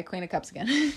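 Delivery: normal talking voice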